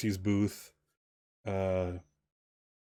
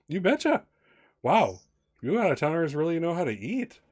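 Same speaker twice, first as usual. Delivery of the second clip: chirpy waitress; 7 semitones higher than usual. Acted. Waitress Charlene reacts to the orders.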